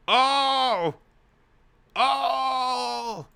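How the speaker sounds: high, loud drawn-out "Oh!"; big, high-pitched outcry; shocked shout. Real, not acted. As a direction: yell a long, stunned "Oh!". Streamer shocked by the story's line.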